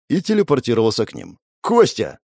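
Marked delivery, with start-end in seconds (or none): 1.6-2.2 mild shout. Acted. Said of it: "крикнул Ли".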